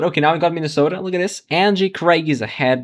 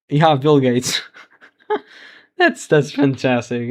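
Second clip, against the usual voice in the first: laughing delight; 4 dB louder than usual. Real, not acted. Delighted by Bill Gates candidacy.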